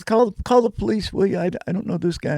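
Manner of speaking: high pleading woman's voice; frightened woman. Retold or imitated. Woman begging a stranger to call police, high and pleading. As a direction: pitch up into her panicked plea.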